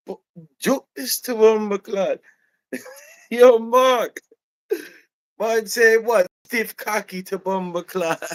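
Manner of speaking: very high, scandalized Jamaican outburst; Jamaican accent. Retold or imitated. Employee mishearing hardwood, calling Mark in loud Jamaican outrage. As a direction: holler outrage in thick imitated patois. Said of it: "the Jamaican goes like".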